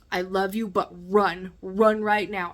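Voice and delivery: loud, urgent warning; loud plea to flee; urgent command. Retold or imitated. Urging brother to flee.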